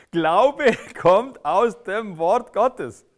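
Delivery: slow, loud proclamation; preaching at full force. Acted. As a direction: spell out every word like a verdict. Preacher proclaims the key verse.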